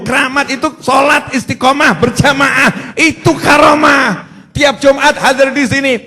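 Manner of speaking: loud, emphatic sermon, driving each line; full-force preaching. Acted. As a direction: proclaim true karomah to the crowd.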